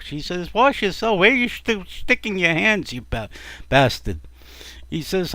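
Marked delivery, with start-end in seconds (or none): 0.5-4.2 shrill scolding wife. Acted.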